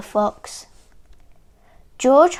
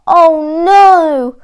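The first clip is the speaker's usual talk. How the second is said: loud, high cry of dismay; storybook wail, big and high; 7 semitones higher, 11 dB louder than usual. A narrator's reading. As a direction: cry out as if all hope is lost. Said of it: "cried George".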